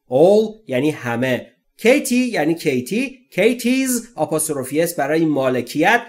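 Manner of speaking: ordinary talk, nothing marked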